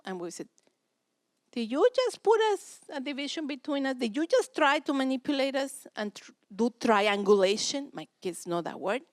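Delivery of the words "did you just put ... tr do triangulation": much higher, louder scolding challenge; stern confrontation. Acted. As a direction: call the kid out on manipulation. Parents confront manipulating child.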